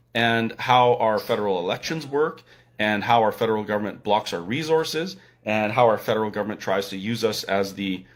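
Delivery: ordinary talk, nothing marked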